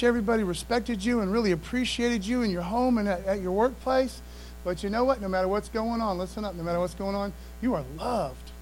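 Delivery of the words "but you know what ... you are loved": tender reassurance. Real, not acted. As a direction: lean in and comfort them gently.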